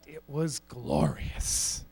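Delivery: slow, low, hushed reverie; hushed savouring. Acted. Comic recalls his day as Willy Wonka, hushed and savouring. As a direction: savour it slowly, almost to yourself.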